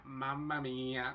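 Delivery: slow, drawn-out Italian mamma mía; Italian accent. Retold or imitated. Switching from Spanish to Italian, a slow Italian exclamation. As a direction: savour it like a delighted Italian. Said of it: "me enamoré completamente del italiano y dije".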